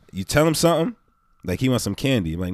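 ordinary delivery

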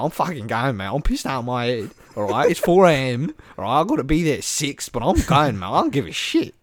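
drunk Aussie bloke impression; hammed-up Australian who won't be stopped; Australian accent. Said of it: "everything you expect from an Aussie".

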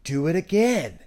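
mock-angry little kid insisting; kinda mad kid. Kid orders skater to go again, imitated mad demand. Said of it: "he got kinda mad".

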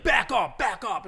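loud, repeated imitated command; warning shout. Man holding out a knife to the group, warning shout. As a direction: bark it twice to keep people away. Retold knife holder warns people away.

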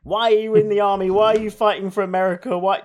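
hammed-up shrill-voiced complaint, mocking the movie wife; nagging shrew. Movie wife nags her soldier husband, mock nagging shrew. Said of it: "a sort of nagging shrew".

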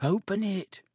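high, anxious urging. A narrator's reading. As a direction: press your friend to act, pitched high. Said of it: "Neville urged".

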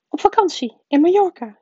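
high, loud snarled answer; snappish aunt. A narrator's reading. Aunt Petunia answers her husband's question, snapping. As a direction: snap back high and loud. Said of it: "snauwde tante Petunia".

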